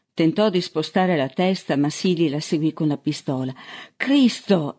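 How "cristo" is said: higher-pitched startled outburst; alarmed exclamation. A narrator's reading. Man with a gun at his ear, exclaiming in alarm. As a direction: exclaim it in protest. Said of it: "esclamò".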